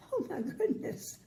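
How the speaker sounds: ordinary delivery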